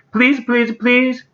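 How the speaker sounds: very high, repeated begging; pleading boy's cry. A narrator's reading. Toby begging his parents, high pleading cry. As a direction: beg like a desperate little kid. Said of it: "cried Toby".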